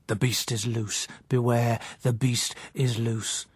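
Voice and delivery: slow, hollow dream voice calling out; a disembodied warning from the emptiness; sepulchral voice. Acted. A dream voice warning the empty city, slow and sepulchral. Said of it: "a sepulchral, disembodied voice called".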